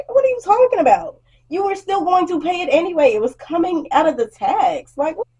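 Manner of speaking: fed up with a customer's logic; incredulous. Real, not acted. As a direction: ask it in total disbelief.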